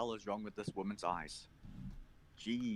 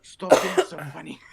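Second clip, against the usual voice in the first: loud, higher, through laughter; laughing; 8 semitones higher, 12 dB louder than usual. Real, not acted. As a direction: beg them to stop, still cracking up. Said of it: "I'm laughing so hard".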